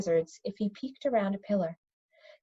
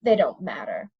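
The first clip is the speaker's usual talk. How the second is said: dismissive; 6 semitones lower than usual. Acted. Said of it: "Zeminar said dismissively".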